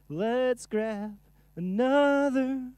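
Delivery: high, slow, loud goofy announcer voice; silly high voice. Pretend. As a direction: ham it up like a cartoon.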